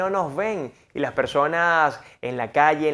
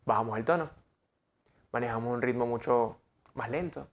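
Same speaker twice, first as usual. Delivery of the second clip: soft low seductive tone; 4 semitones lower, 9 dB quieter than usual. Shown as a demonstration. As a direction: lower and much softer, intimate. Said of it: "¿Cómo tenemos que hablar?".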